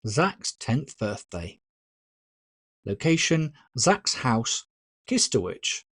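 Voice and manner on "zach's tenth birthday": ordinary delivery, nothing marked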